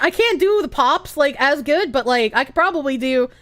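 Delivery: usual speaking voice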